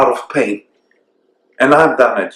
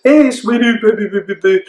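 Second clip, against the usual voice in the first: put-on high sing-song baby talk; 8 semitones higher, faster than usual. Shown as a demonstration. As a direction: coo at a baby, pitched way up. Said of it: "a baby voice?".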